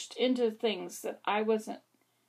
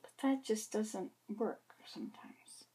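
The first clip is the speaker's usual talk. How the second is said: soft, slow whispered aside; 10 dB quieter than usual. Real, not acted. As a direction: confide it under your breath. Confiding aside, voice drops.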